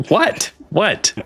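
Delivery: high, incredulous double take; baffled nun. Acted. Nun thrown by a change of topic, baffled and high. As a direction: pitch shoots up in disbelief. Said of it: "And she's just like".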